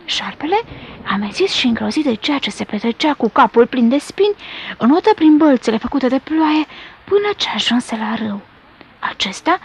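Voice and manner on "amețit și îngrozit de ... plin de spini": usual speaking voice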